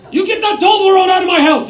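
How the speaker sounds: dad banishing chocolate from the house in mock fury; mock angry dad. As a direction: play the outraged dad, half in jest.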